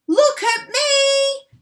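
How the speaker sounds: very high, loud and slow crone's yell; shouting grandma. A narrator's reading. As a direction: call out for attention from the roof. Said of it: "Grandma shouted from the rooftop".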